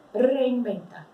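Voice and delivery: "Reinventa" slowly, rolled R and V said as B; Spanish pronunciation, slow. Shown as a demonstration. Teacher modeling Spanish "Reinventa", rolled R and B. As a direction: say "Reinventa" with trilled R and B sound. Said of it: "som do B e forcei o R".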